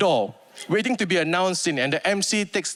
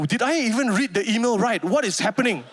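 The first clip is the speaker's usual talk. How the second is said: nervous panic; 4 semitones higher than usual. Retold or imitated. Panicked inner monologue.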